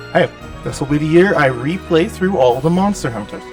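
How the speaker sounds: ordinary talk, nothing marked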